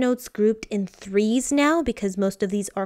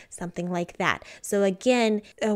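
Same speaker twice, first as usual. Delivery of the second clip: ordinary talk, nothing marked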